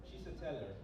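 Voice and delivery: ordinary delivery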